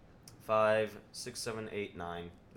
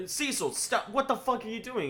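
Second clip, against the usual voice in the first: high, aghast mock shout; exasperated bystanders; 9 semitones higher than usual. Bystanders scolding Cecil at the wedding, high mock outrage. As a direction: yell at him in disbelief. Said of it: "everyone's like".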